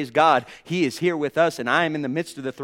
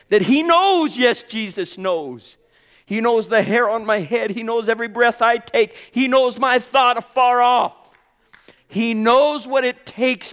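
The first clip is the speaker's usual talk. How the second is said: soaring, loud preacher's cry, slow and stretched; full-force preaching; 7 semitones higher, 8 dB louder than usual. Acted. Preacher crying out to brothers and sisters, full force. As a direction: preach it hard to the congregation.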